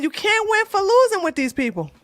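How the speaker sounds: ordinary delivery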